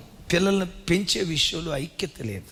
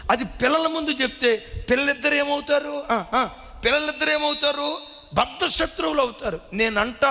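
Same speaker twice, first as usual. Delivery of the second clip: higher, pressing sermon delivery; impassioned preaching; 7 semitones higher than usual. Acted. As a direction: warn the congregation urgently. Preaching at raised pitch.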